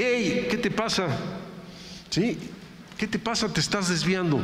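inner spirit scolding. Pretend. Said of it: "mi espíritu me dice".

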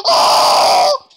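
very high, loud squawking shout; shrill chicken-like shout. Real, not acted. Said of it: "I sounded like one of those chickens".

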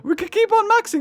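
high-pitched amazed squeal; thrilled disbelief, way up high; excited. Real, not acted. Excited disbelief at huge damage.

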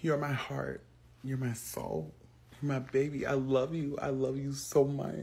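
soft loving parent; tender. Acted. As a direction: coo it softly.

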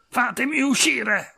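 high, drawn-out trapped yell; high-pitched, frantic demand; furious yelling. A narrator's reading. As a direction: yell to be let out, slowly and high.

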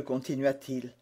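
ordinary delivery

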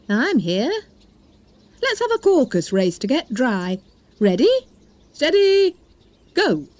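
pompous bird calling the race; dodo voice. Acted. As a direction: announce the race like a fussy old bird.

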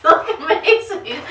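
loud laughing commands. Real, not acted. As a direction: yell at her to stop. Shouted leave it.

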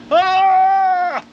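sudden high yelp of dismay; pained yelp. Pretend. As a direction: yelp like something just broke. Reacting to costly damage.

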